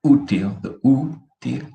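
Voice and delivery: útil with the ú stressed, then stretched slowly; stress on first syllable. Shown as a demonstration. Teacher pointing out emphasis, stressed first syllable of útil. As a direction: draw out the ú of útil. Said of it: "I emphasize on the first word".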